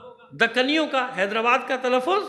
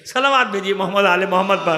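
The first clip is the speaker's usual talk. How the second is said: louder call to the congregation; loud salawat call; 2 semitones lower, 9 dB louder than usual. Real, not acted. Preacher rouses the gathering to salawat, loud call. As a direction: call out the blessing.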